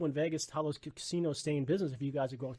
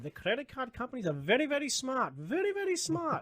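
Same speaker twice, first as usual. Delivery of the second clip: put-on much higher, slowing Indian accent on "very, very smart"; imitating professor's Indian accent, building higher; 11 semitones higher, 4 dB louder than usual. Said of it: "in his Indian accent".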